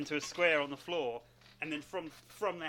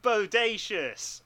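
loud surfer dude voice; 4 semitones higher, 7 dB louder than usual. Pretend. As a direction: ham up the dude talk. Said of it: "They don't talk like that at all".

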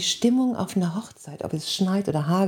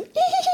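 very high, sheepish giggle; imitated giggle. Speaker imitating the groom's embarrassed giggle, very high. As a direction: giggle like a caught schoolboy. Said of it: "Und er schon so".

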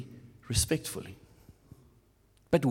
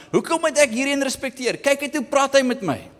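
a hint of higher, faster squabbling voices; mock bickering couple; 8 semitones higher than usual. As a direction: trade complaints like a stuck couple. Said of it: "vasgevang is van".

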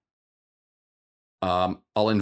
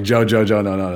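louder, urgent pleading of a name; urgent calling out; 7 dB louder than usual. Retold or imitated. Stopping his friend Joe from fighting, calling out loudly. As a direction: call out to stop your friend.

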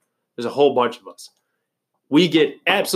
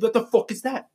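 outraged celebrity chef, higher; Gordon Ramsay; 9 semitones higher than usual. Retold or imitated. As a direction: bark it like a furious TV chef. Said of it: "That's my Gordon Ramsay impression".

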